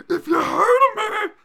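high, slow screaming mock of a fighter; imitating Devin Clark. Host imitating the fighter yelling between rounds. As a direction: shriek it slowly like a wild fighter between rounds. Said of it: "That's my favorite of that clip".

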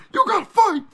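high loud yelled imitation; mock screaming fighter. Host imitating the fighter screaming in his corner. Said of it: "He goes".

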